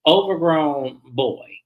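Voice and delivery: normal talking voice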